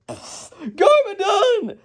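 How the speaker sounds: very high, drawn-out cry; squealed, slow mock alarm; citizen's cry. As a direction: cry out the villain's name in panic. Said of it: "one of the citizens at the hot dog stand is like".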